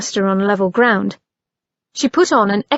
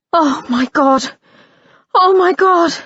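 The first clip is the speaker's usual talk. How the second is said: rising alarm, high and loud at the end; panicked cry; 7 semitones higher, 3 dB louder than usual. A narrator's reading. Anna running down the stairs in dread, panicked cry. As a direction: build from dismay into a frightened cry. Anna realises Edward's danger.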